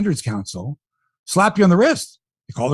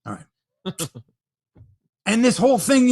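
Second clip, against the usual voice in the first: usual speaking voice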